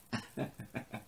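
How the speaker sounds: soft, voiceless chuckling fit; quiet airy wheeze of laughter; wheezy laugh. Real, not acted. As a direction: laugh on the breath alone. Cracks up at quoted line.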